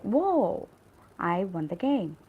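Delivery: demonstrated burst of excitement; excited. Shown as a demonstration. Teacher giving an example of an exclamatory sentence, excited. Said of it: "It could be sounding excited".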